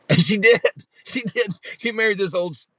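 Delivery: real laugh breaking into high-pitched words. Real, not acted. Telling how sweetheart said yes, laughing. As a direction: laugh through it, voice jumping up. Laughing at own marriage story.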